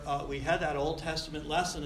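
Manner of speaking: usual speaking voice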